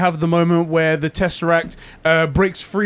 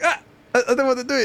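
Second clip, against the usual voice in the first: high, whiny reluctance; 7 semitones higher, 3 dB quieter, much faster than usual. Retold or imitated. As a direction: whine it like a sulky kid. Mock Loki reluctant after crash.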